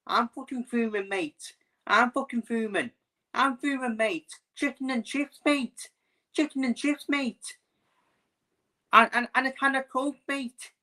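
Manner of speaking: high-pitched Scouse fan rant; mock Liverpool accent. Streamer imitating fuming Liverpool fans, exaggerated high Scouse accent. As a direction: put on an indignant, high Scouse voice. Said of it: "I can do the Stealth accent perfectly".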